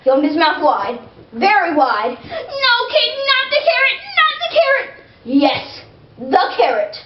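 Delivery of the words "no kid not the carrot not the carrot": high, frantic pleading; panicked tooth. Acted. Tooth pleads as the carrot comes, high panic. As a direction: beg in rising panic.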